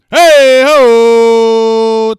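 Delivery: very loud, high cheer call; big hey ho call; hype shout. Real, not acted. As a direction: belt it out to the stream.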